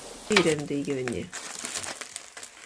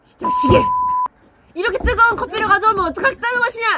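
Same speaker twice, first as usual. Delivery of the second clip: high, loud outraged scolding; furious lord; 13 semitones higher, 5 dB louder than usual. Acted. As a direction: explode at the servant in mock rage. Mock lord rages over hot coffee.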